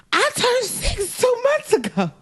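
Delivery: put-on high, slow little girl insisting; child voice. Little girl correcting her mom, slow and high-pitched. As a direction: whine it like a small kid.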